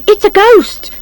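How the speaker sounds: high cry of alarm; high-pitched, panicky exclamation; frightened cry. A narrator's reading. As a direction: cry out as if startled. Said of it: "Missus Nosey cries".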